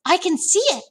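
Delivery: put-on high-pitched excited girl; startled storybook Sophie; crying out. A narrator's reading. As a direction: exclaim it high in discovery. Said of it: "cried out".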